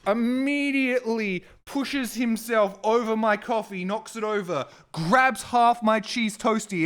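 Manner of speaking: angry ranting narration; real angry rant. Real, not acted. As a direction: tell it furious, still seething. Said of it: "I'm very angry".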